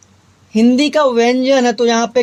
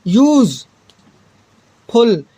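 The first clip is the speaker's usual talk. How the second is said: Useful stretched very slow, sound by sound; 3 semitones lower, much slower than usual. Shown as a demonstration. Teacher sounds out useful very slowly for the students. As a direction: drag out useful to hear its first sound. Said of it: "इसको हिंदी में लिख के देखना है".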